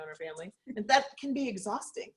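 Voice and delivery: usual speaking voice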